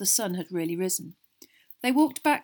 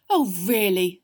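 exasperated; 8 dB louder, slower than usual. A narrator's reading. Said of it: "said Mister Weasley in exasperation".

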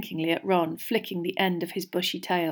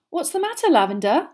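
high, concerned question; anxious; 8 semitones higher, 5 dB louder than usual. A narrator's reading. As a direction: ask with gentle worry. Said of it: "said Hermione anxiously".

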